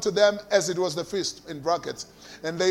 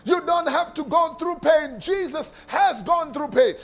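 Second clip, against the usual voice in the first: higher, half-sung preaching that builds; rising sermon cadence; 4 semitones higher than usual. Acted. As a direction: carry them upward, chanting each phrase.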